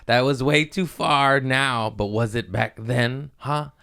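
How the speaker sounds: high teasing mocking voice; sing-song taunt at his past self. Mocking his younger self, teasing sing-song. Mocking himself as old edgy comic.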